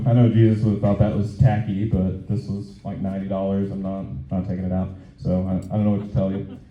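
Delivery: usual speaking voice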